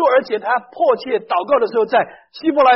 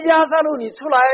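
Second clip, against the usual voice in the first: calling out loud; 5 semitones higher, 5 dB louder than usual. Acted. Jesus calls Lazarus out of tomb.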